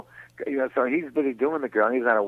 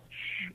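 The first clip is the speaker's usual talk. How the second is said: hushing shh; 17 dB quieter than usual. Retold or imitated. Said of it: "I'm going".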